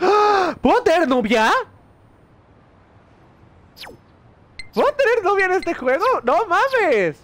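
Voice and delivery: thrilled squeal of surprise; excited. Real, not acted. Discovers dating in game.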